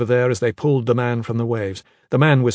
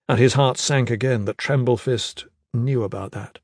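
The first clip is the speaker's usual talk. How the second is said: ordinary delivery, nothing marked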